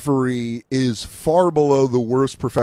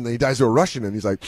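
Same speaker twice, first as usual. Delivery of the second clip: usual speaking voice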